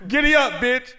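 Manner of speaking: playful barked command; mock order. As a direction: boss the valet around for a laugh. Barking order at valet.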